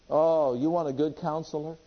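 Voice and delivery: ordinary talk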